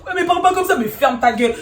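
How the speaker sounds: loud, high angry retort; re-enacted squabbling; retold shouting match. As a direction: yell back over the other person. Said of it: "ça crie".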